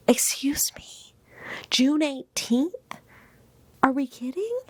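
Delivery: can't believe the date; incredulous. Real, not acted. To viewers, incredulous at the delivery date.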